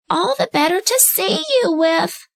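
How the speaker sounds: high put-on old lady voice; the wolf sweetly pretending to be grandmother; wolf in disguise imitating grandmother; wolf faking granny.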